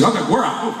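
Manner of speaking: laughing. Retold or imitated. Sudden retreat with laughter.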